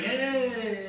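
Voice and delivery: high, loud drawn-out greeting; party-guy whoop. Acted. Partygoer reverting to old ways, whooping hello. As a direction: holler like you own the party. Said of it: "Started reverting".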